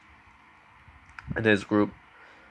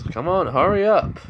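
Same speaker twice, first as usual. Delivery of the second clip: louder, higher call to hurry; raised voice, pressing someone on; urging; 7 semitones higher, 5 dB louder than usual. Retold or imitated. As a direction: call out to hustle them along. Bon urges the others along.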